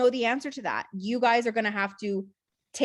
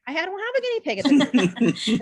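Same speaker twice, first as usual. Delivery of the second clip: exasperated mock reply; 6 semitones higher, 5 dB louder than usual. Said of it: "I'm like".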